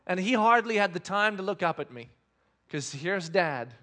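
ordinary delivery

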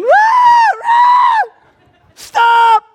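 wild high yell, then a loud "stop"; acted yelling. Preacher acting out apostles running in, high frantic shout. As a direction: charge in yelling at full force.

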